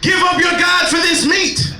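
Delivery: high shouted mock decree; Spanish Inquisition hype man. Hype man as Spanish Inquisition shouting at the sleeper. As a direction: demand obedience like a crazed inquisitor. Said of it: "I'm the Spanish Inquisition".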